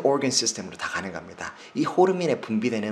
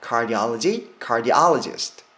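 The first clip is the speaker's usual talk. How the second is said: "Cardiology, cardiologist", each with a loud "ah" on the O; stress on O; 6 dB louder, much slower than usual. Shown as a demonstration. Teacher setting field beside specialist, stressed O. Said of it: "여기가 강세를 받는 부분입니다".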